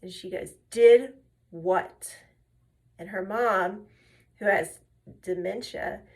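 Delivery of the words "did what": loud, incredulous retold question; mock shocked disbelief. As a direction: imitate someone aghast, punched out loudly. Retold shocked question after insult.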